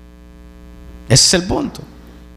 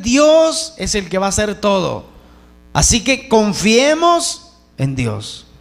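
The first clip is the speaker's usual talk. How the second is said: ordinary talk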